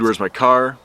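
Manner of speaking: usual speaking voice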